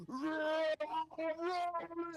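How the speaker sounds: high, loud playful roar; mock roar. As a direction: roar it out like a beast. Imitating Tarkus roaring.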